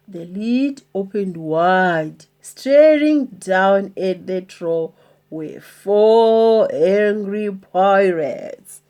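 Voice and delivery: ordinary delivery, nothing marked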